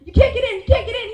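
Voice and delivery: loud, high taunt through the door; teasing shout. Retold or imitated. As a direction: hold the door and gloat.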